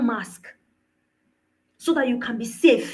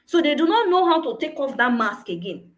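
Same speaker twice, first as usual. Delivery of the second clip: ordinary delivery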